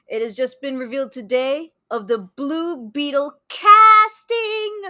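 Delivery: building to a loud, high last word; excited announcement. Real, not acted. Streamer revealing Blue Beetle casting, excited build to a shout. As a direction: announce it big, stretching the final word.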